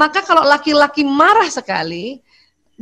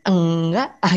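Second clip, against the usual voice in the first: low, drawn-out denial with a rising end; lightly acted sulky "no"; angry wife's denial; 7 semitones lower, slower than usual. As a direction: deny it like a mildly peeved wife. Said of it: "wanita sering nggak terus terang kalau dia marah".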